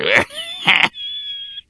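high wheezing cackle; wheezing goblin laughter. Acted. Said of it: "The whole band wheezed in laughter".

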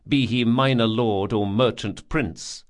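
ordinary delivery, nothing marked

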